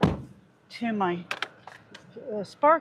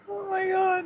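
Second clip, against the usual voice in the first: exasperated cry; 11 semitones higher than usual. Real, not acted. Phone keeps misbehaving.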